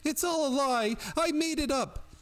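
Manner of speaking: put-on very high-pitched hysterical girl's voice; shrill, tearful outburst. A narrator's reading. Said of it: "a shrill, tearful voice burst out with".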